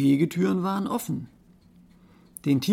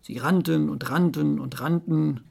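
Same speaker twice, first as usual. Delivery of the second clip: usual speaking voice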